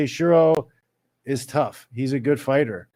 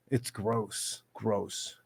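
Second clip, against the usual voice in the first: softer, lower disgusted repeat; quiet; 4 semitones lower, 12 dB quieter, much slower than usual. Real, not acted. Repeating his disgust at announcers under his breath. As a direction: drop it low and soft in disgust.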